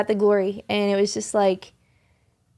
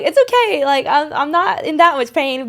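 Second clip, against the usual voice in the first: much higher, louder, reassuring them while still crying; reassuring through tears; 5 semitones higher, 5 dB louder than usual. Retold or imitated. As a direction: play down the tears, pitched way up. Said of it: "And I'm like".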